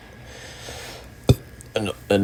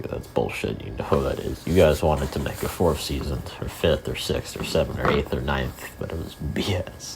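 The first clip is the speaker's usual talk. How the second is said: lower, softer grumbled gripe; mock grumble; 4 semitones lower, 7 dB quieter than usual. Fan griping about the show getting canceled, low grumble. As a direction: grumble it under your breath, fed up.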